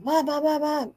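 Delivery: put-on high, hushed child's call of Mom; a scared kid calling out from bed in the dark. Little girl in bed calls out to her mom in the next room, high and hushed.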